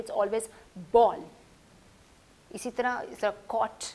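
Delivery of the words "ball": ball with rounded "aw" vowel; correct pronunciation. Shown as a demonstration. Teacher giving the usual English word, correct pronunciation. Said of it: "जब भी कभी ये word सुनेंगे".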